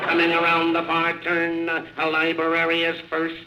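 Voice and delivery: brisk racetrack announcer play-by-play; race call. Acted. Announcer calls the race to the crowd, live play-by-play.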